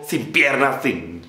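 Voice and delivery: usual speaking voice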